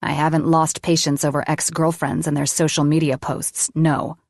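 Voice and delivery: ordinary delivery, nothing marked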